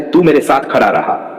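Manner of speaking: normal talking voice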